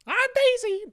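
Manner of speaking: very high, loud squeal of her name; Daisy scream. Retold or imitated. Friend outdoes the Daisy squeal, very high and loud. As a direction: shriek it like the princess on court. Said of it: "scream that she's Daisy".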